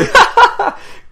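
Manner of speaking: high, loud laughing fit; burst of laughter. Real, not acted. Podcast host cracking up at co-host's joke, loud high laugh. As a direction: crack up sharply.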